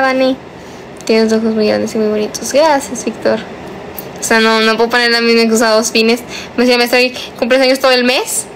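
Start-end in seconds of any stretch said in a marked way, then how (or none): none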